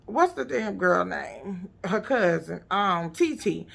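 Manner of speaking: usual speaking voice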